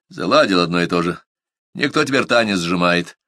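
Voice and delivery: plain reading voice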